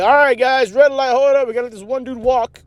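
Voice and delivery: high, put-on hold-up call; mock traffic announcer. Stopping drivers for one walker, mock announcement. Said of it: "Instead of being like".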